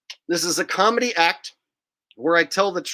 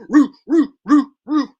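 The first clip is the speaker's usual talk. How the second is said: high, loud "roo, roo" barking cheer; Arsenio Hall woof chant; 6 semitones higher, 8 dB louder than usual. Pretend. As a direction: whoop it like an old talk-show crowd. Mock crowd chant.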